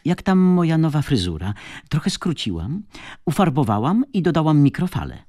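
high, lively, showing off; chatty coquettish woman. A narrator's reading. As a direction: fish for a compliment in a high voice. Tatiana flirts about her new hairdo.

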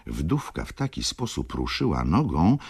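ordinary delivery

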